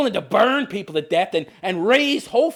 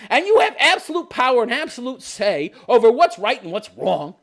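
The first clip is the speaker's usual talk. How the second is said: ordinary delivery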